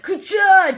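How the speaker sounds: a sharp, loud, high mouth-made ka-cha; gunshot sound effect. Retold or imitated. Recapping the ambush scene, a loud imitated gun click. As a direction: fire off the noise like a gun cocking.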